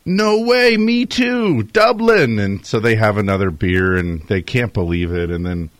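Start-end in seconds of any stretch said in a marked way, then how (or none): 0.1-2.4 much higher, louder mock disbelief; amazed joke character. Irish drinker hears they share a hometown, hammed-up amazement. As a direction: act out delighted astonishment at the coincidence.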